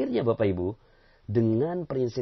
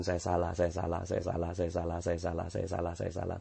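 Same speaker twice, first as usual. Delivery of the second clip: a humble, softer repeated confession; soft repentant murmur; 5 semitones lower, 5 dB quieter than usual. Shown as a demonstration. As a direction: quietly admit fault, over and over.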